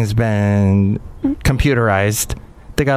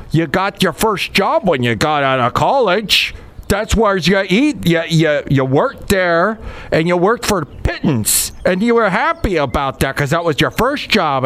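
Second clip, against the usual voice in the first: higher, hammed-up old conservative host voice; mock talk show host; 5 semitones higher than usual. Mocking a conservative talk show host, pitched up and preachy. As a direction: lecture like a cranky radio host, pitched up. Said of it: "But he's like".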